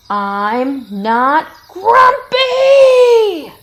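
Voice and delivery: loud, slow, drawn-out shout. A narrator's reading. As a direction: bellow it while beating your chest. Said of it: "shouted Jim as he beat his chest".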